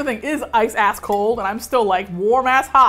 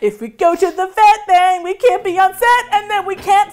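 rising louder and higher with each step; building excitement; 5 semitones higher, 6 dB louder than usual. Acted. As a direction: build it up link by link. Escalating chain of consequences.

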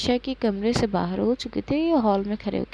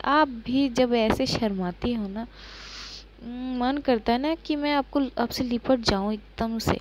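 ordinary delivery

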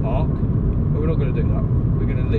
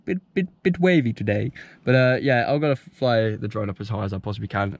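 ordinary delivery

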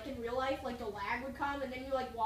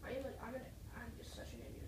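whispered, much softer; ninja whisper; 3 semitones lower, 15 dB quieter, faster than usual. Pretend. Sneaking past enemies, whispering to teammate.

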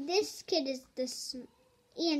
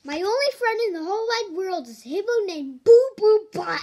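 louder, fast and a bit higher; loud silly read; 4 semitones higher, 9 dB louder, faster than usual. Acted. Reader announcing the hippo friend, loud and fast. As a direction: rattle it off, hamming it up.

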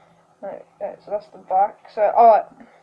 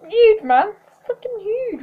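excited; 10 semitones higher, 3 dB louder, slower than usual. Real, not acted. Excited by a big pull.

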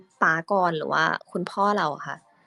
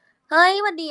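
bright hello pitched much higher and louder; normal higher voice; 11 semitones higher, 6 dB louder, slower than usual. Shown as a demonstration. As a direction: pop the greeting up high and loud. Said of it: "ก็พูดปกติได้แหละ แบบ".

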